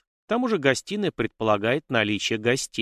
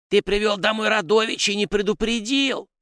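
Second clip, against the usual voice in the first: very high, indignant creature cry; shouting house spirit; 11 semitones higher, 4 dB louder than usual. A narrator's reading. House spirit scolds his master from behind the chair, high indignant shout. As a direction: cry out in a squeaky, outraged little voice. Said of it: "одновременно крикнул домовой".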